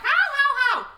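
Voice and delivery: very high, loud ho ho ho; high jolly laugh. Pretend. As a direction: start high and booming. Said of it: "He starts out like".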